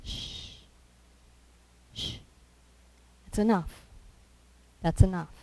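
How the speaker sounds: soft, spaced-out shushing; gentle hushing. Acted. Jesus calming the storm at sea, soft hushing. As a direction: hush it quietly, with pauses. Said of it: "what he said was".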